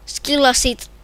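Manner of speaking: usual speaking voice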